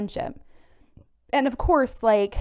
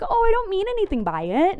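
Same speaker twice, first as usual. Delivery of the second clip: much higher, put-on dismissive voice; mocking the friend; 11 semitones higher than usual. Mimicking the friend who brushes off her hurt, in a high mocking voice. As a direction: toss it off in a silly high voice.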